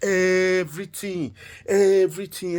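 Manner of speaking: "everything" drawn out long and emphatic; emphatic stretch. A narrator's reading. Storyteller marvels that he bought it all, "everything" stretched slowly for emphasis. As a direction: stretch the first syllable of "everything".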